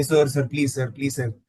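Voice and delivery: a soft, lightly voiced imitation of someone begging "sir, please"; imitating nagging beggars. Recalling beggars who nag, a soft mock-pleading imitation. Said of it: "sometimes we find them nagging".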